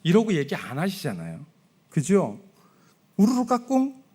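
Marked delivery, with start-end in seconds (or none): 3.2-4.0 put-on higher, playful cooing at a baby; baby talk peekaboo. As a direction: coo it up high like to a newborn. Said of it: "뭐 이거 아니에요?".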